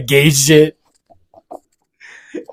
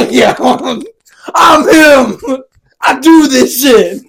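high slow triumphant yelling; hype shout; 13 semitones higher, 6 dB louder, much slower than usual. Acted. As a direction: shout like a champion. Imagined celebration.

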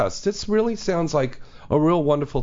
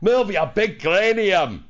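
higher-pitched mock Scottish movie impression; Glaswegian accent; 4 semitones higher, slower than usual. Host imitating Mike Myers in So I Married an Axe Murderer, Glaswegian accent. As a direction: bark it in broad Glaswegian. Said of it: "That's Glaswegian".

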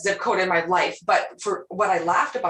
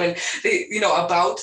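ordinary talk

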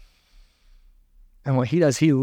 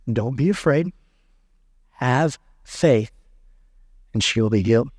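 gentle reassurance; 2 semitones lower than usual. Acted. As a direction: draw out have faith tenderly. Jesus comforting grieving father.

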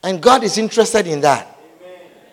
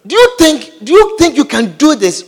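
higher, louder questioning interviewer; doubtful interviewer; 7 semitones higher, 4 dB louder than usual. Acted. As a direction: press the candidate with a raised, doubting question. Voices the job interviewer.

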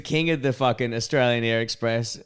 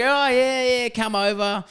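higher, louder, slowed eager welcome; eager boss; 10 semitones higher, 3 dB louder, slower than usual. Retold or imitated. Lonely boss waving the boys over, hammed-up eager welcome. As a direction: beckon the boys over, all too keen. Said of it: "he's like".

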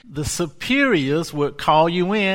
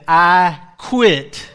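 louder, blunt two-word resignation; fed-up loud quit; 2 semitones higher, 8 dB louder than usual. Retold or imitated. Monk telling his superiors with a loud fed-up resignation. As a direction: toss it out like you've had enough. Monk quits after thirty years.

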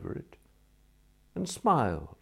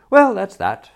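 brisk triumph; 13 semitones higher, 16 dB louder than usual. A narrator's reading. Bertie closes the argument.

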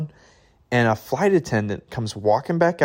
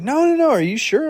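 much higher, louder disbelieving no no no; surprised protest; 5 dB louder than usual. Retold or imitated. Fellow traveler offered the standby seat, high surprised protest. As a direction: voice jumps way up in polite disbelief.

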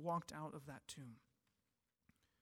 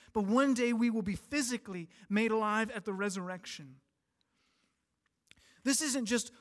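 usual speaking voice

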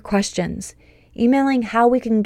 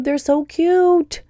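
gushing; 8 semitones higher, much slower than usual. Real, not acted. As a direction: melt over how cute they are. Gushing over kindergartners.

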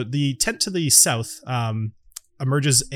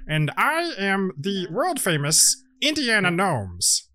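higher-pitched, self-important gnome showman; Indiana Gnomes; 4 semitones higher, slower than usual. Acted. Gnome introduces his crew to visitors, higher showman voice. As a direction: make grand introductions, stretching out the names. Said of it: "the gnome introduces him. He says".

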